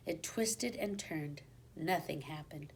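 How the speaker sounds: tense whisper. A narrator's reading. Tense night scene at door.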